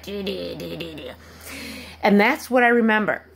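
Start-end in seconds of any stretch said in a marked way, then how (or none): 0.0-1.1 quiet rattling imitation of crumpling metal; hushed imitated crunch, trailing away; can-crushing noise. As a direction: softly stutter out a crunching can. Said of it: "crushing of a can, like".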